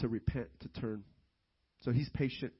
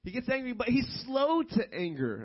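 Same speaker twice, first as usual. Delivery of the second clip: lightly imitated child, higher pitch; a hint of a kid getting it; son catching on; 8 semitones higher, 4 dB louder than usual. Son tells dad he understands, lightly voiced higher. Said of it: "it just clicked for him".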